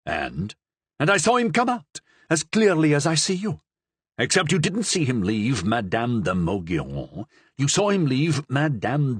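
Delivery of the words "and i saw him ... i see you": higher, quicker insistence; insistent. Acted. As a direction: insist he saw it clearly. Pisani insists, excited.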